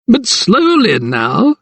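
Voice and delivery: slow, high, smirking magistrate; knowing smile. A narrator's reading. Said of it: "his lips curling in a knowing smile".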